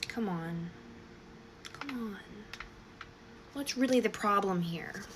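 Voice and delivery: soft, patient teacher imitation; hushed, measured teacher reasoning with someone; third grade teacher voice. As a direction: gently coax like a primary school teacher. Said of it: "He uses his third grade teacher voice".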